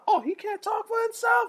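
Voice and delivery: high, squeaky mocking taunt; hammed-up high teasing voice. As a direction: needle him like a heckler. Dudes taunting him.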